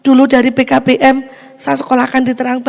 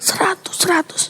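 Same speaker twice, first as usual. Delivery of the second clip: awed whisper; 6 semitones higher, 5 dB quieter than usual. Real, not acted. Awe at perfect score.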